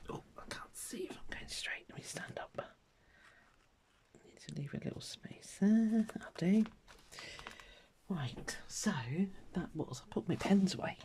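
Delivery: whispering to herself while lining up the pen; hushed unvoiced mutter as she concentrates. Real, not acted. Crafter murmuring to herself over her drawing, whispered.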